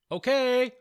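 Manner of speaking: loud, high shout. Acted. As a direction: call it out loudly. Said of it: "Lucy and Georgia shouted".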